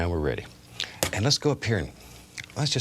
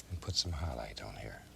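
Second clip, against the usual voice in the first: whispering; 5 semitones lower, 9 dB quieter than usual. Real, not acted. Soft painting aside.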